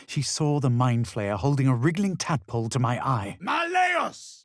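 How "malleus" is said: very high shouted incantation; shouted spell. Acted. Adventurer casting a hammer spell, shouted high. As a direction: hammer the word out.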